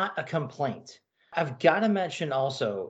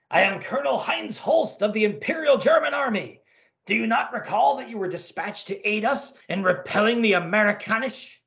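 put-on gruff German officer, German accent; comic-book Nazi colonel, German accent; 7 semitones higher than usual. Colonel introduces himself.

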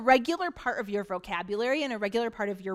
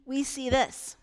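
ordinary talk, nothing marked

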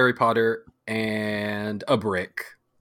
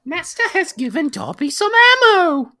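high, loud house-elf squeal; hammed-up elf, rising to a gleeful shriek; Dobby; 7 dB louder than usual. House-elf given ammo by master, squealing glee.

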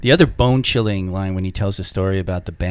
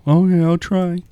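higher-pitched mocking trainee voice; 8 semitones higher than usual. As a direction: imitate a trainee's half-hearted promise.

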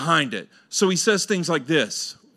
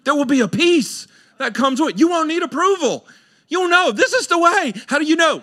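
higher and louder, building preacher's cry; preaching climax; 11 semitones higher, 3 dB louder than usual. Acted. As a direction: lift it up like the sermon's peak.

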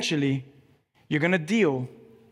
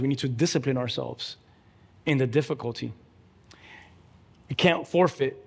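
ordinary delivery

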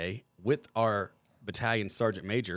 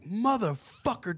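drawn-out, high-pitched curse; retold scream; 12 semitones higher, 3 dB louder, much slower than usual. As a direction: stretch out the pained outburst, pitch leaping up. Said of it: "and I'm screaming".

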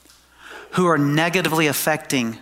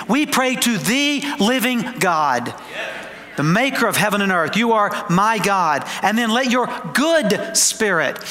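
emphatic preaching; 5 semitones higher than usual. Real, not acted. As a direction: preach it with conviction, stretching the big words. Preaches with rising force.

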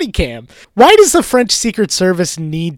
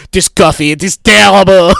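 louder, snooty mock French accent; 6 dB louder than usual. French agent disdains American coffee, in a mock French accent. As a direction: sniff at it in a fake French accent. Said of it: "And like".

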